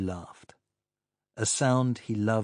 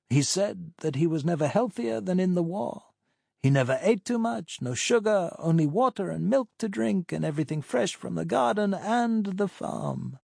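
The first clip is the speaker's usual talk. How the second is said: friendly partner, pitched higher; chatty old doctor, higher voice; 8 semitones higher than usual. Acted.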